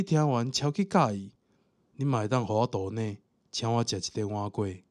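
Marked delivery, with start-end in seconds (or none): none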